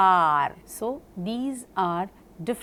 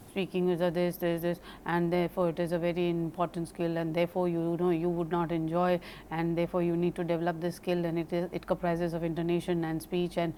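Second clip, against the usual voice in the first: quick, softer drone on one pitch; boring monotone; 3 semitones lower, 4 dB quieter, faster than usual. Shown as a demonstration. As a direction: drone on without any voice modulation, running on. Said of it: "If I continue like this".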